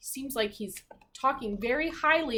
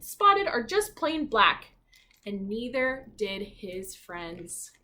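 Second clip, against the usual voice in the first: lively, expressive storybook narration; reading to children; 2 semitones higher, 6 dB louder than usual. A narrator's reading. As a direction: read it like a bedtime picture book.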